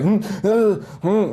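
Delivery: high, strained humming through held-back tears; strained hum. Retold or imitated. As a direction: squeeze out a choked hum. Trying and failing to cry.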